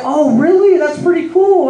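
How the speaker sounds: impressed clerk. Retold or imitated. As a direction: gush in a mock clerk's voice. Impressed clerk voiced in a story.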